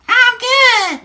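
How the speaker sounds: put-on voice, very high and loud; girl's chat voice. Streamer voicing a teen girl's chat message, high put-on voice. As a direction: squeal it like a cartoon girl. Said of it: "forgive my voices".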